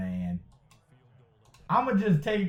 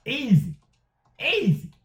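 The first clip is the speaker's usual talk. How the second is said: excited exclamation; 9 semitones higher than usual. Real, not acted. Exclaiming over an easy play.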